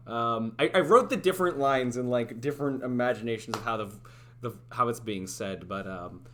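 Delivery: usual speaking voice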